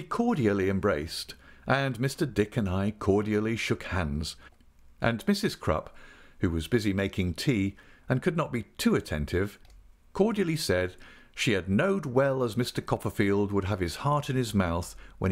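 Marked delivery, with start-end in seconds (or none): none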